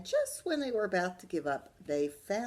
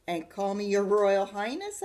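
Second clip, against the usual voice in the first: louder cheeky little sister; bossy demand; 3 semitones higher, 9 dB louder than usual. Acted. D W demanding a title from Arthur, loud and cheeky. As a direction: push your luck loudly. D W bargaining boldly.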